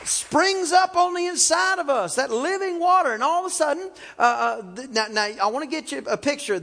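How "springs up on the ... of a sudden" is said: preaching refrain. Acted. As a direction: lift the words like a hymn.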